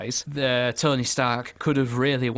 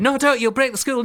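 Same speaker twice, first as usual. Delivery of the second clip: high, loud panicked warning; panicked shout; 12 semitones higher, 6 dB louder than usual. Retold or imitated. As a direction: shout it out in mock alarm. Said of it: "he said".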